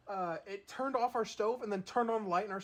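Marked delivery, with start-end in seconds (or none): none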